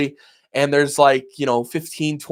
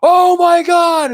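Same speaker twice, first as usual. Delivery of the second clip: shocked gasp; 13 semitones higher, 4 dB louder, much slower than usual. Retold or imitated. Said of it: "you're like".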